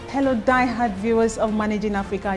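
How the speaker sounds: normal talking voice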